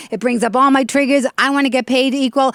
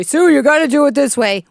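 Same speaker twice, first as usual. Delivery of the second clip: a slightly higher, louder lecturing tone; mansplaining voice; 5 semitones higher, 4 dB louder than usual. Shown as a demonstration. Host mimics a man giving unasked advice, mildly condescending. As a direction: talk down to her like she asked for advice. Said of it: "you put your voice like this".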